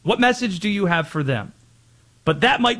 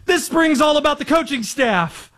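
higher, hammed-up frantic self-correction; mock panicked coach; 8 semitones higher than usual. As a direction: playfully mimic a flustered coach. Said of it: "He caught himself ... saying".

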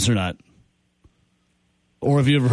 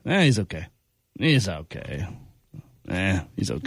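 low, dismissive shrug of a verdict; unimpressed inflection; 9 semitones lower, 4 dB quieter than usual. Shown as a demonstration. Host showing how tone changes an answer, low and lukewarm. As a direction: let it sink low, barely endorsing him. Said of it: "It's all about inflection, tone, right?".

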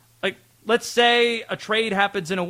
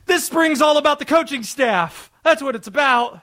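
a hammed-up, higher coach reciting a canned line; mock coach soundbite; 8 semitones higher, 4 dB louder than usual. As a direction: act out the coach's press-conference line with exaggerated conviction. Mocking a coach's canned answer.

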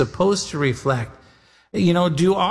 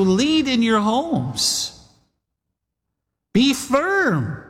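ordinary delivery

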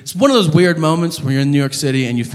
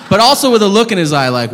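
ordinary talk, nothing marked

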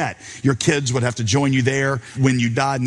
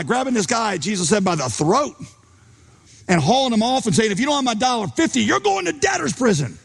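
higher and faster, building to a threatening peak; mounting outrage; 7 semitones higher than usual. Retold or imitated. Story climax, man threatens debtor.

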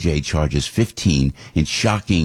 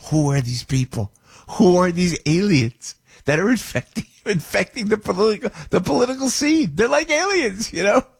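incredulous; 11 semitones higher than usual. Real, not acted. Incredulous rant about aliens.

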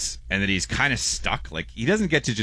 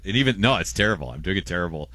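ordinary talk

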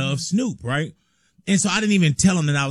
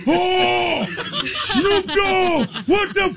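very high, starstruck gasp of recognition; hammed-up amazed outburst, pitched way up; astonished Japanese fan; much slower than usual. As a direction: imitate a fan losing it on seeing his idol. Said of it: "But he's like".

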